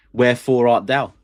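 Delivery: loud, theatrical Shakespeare line; Shakespeare recital. Acted. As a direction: declaim it like a stage actor. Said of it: "I'm a big fan of Shakespeare".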